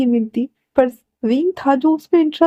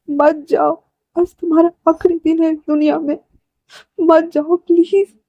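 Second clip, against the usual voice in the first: grief-stricken pleading; 5 semitones higher than usual. Acted. Brother kneeling, begging Wang Ji to stay, grief-stricken. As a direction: plead with him to stay, voice pitched up.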